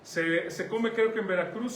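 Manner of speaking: ordinary talk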